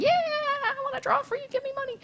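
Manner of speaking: imitating his younger overeager self; mock eager squeak. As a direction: pipe it up like a naive beginner begging for work.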